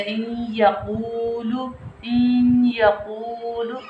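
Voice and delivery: "इन यकूलु" very slow, weight on a long nasal doubled y; stressed ya with ghunna. Shown as a demonstration. Teacher showing where the force falls, nasal pressed y in "इन यकूलु". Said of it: "या के ऊपर ही लग".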